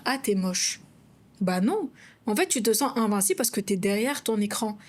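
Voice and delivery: ordinary talk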